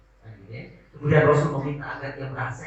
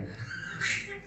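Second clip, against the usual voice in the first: light laugh; 8 dB quieter than usual. Real, not acted. Laughing at gossip.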